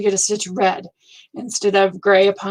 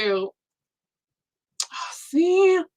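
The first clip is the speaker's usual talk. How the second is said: ordinary talk, nothing marked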